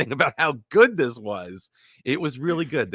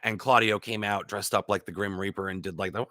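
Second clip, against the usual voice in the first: usual speaking voice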